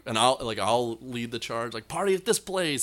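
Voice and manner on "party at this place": high-pitched party call; rally the gang, pitch lifted; acted-out whoop to the group; imitated rallying call. Leading the charge to party.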